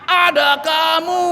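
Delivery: slow, high mock shout; mock baby exclamation. Joking baby voice greeting the world, slow mock shout. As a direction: stretch it out like a surprised newborn. Newborn baby's retold exclamation.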